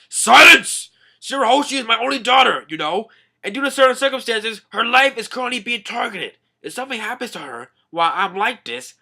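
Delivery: high, loud, fast furious roar; angry king shout. Retold or imitated. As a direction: roar it like an enraged father king. Neptune serious, daughter in danger.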